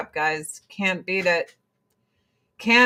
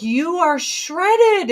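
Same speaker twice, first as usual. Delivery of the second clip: impressed doctor; 10 semitones higher, 4 dB louder, much slower than usual. Retold or imitated. Doctor quoted feeling her shoulder.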